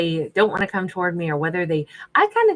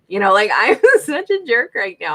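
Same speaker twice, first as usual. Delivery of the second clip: loud laugh through the words; laughing at herself; 3 semitones higher, 7 dB louder than usual. Real, not acted. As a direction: laugh out a self-mocking confession. Laughs at own sass.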